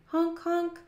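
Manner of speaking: high imitated horn beeps; car horn honk. A narrator's reading. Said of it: "horn honking".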